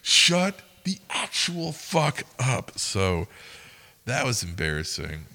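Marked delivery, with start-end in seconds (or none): 0.0-2.8 slow, high, forceful mock reprimand; retold exasperated hushing. Shift leader to worker by the open window, exasperated mock reprimand. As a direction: force it out slowly, word by word.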